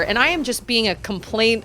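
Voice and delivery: ordinary delivery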